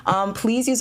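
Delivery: high excited "you see the scene?". Real, not acted. Streamer showing chat her new set, high and giddy. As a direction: show it off with glee.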